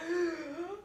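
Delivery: very high, loud disgusted whine; shrill mock disgust; grossed out. Shown as a demonstration. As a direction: act sickened and over the top. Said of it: "but just act grossed out".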